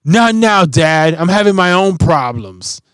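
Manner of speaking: lightly voiced child waving dad away; sassy four-year-old. Four-year-old daughter brushing off her dad, a high, loud sassy voice. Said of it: "And she's like".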